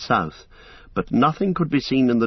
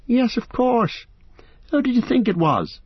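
put-on cheerful young hobbit. A narrator's reading. Merry greeting the frightened travellers, amused. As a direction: tease them for being scared.

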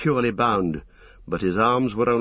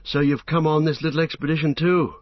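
higher, lightly cheeky hobbit voice; Merry; 6 semitones higher than usual. Acted. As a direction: greet a friend with wry cheer. Merry jokes with Pippin.

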